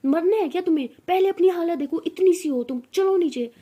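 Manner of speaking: loud exasperated big-sister scolding. Acted. Older sister orders her downstairs.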